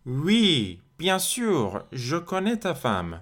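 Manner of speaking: high, swooping, slow French answer; emphatic yes. Acted. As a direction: answer as if it were completely obvious, pitch leaping up. Answers the example question, acting it.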